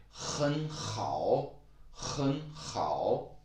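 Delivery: 很好 with a heavy breathy H on 很, slowly; overdone H sound; strong H. Shown as a demonstration. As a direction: exaggerate the H of 很好. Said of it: "pronounce the H very strongly".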